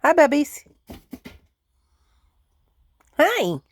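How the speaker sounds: high, loud hello to pets; pet greeting. Real, not acted. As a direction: call out high-pitched hellos to the animals.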